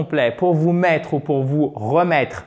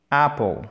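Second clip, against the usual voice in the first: "Apple" with the stress on AP. Shown as a demonstration. Teacher showing students where "Apple" is stressed: on AP. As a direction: punch the first syllable of "Apple". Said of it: "l'accent tonique se trouve ici".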